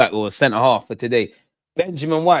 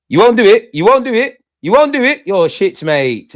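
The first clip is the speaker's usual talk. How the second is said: loud, high jeering taunt, rising each time; 9 semitones higher, 7 dB louder than usual. Real, not acted. Fan jeering an opposing player, loud taunt. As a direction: goad him, louder with each repeat.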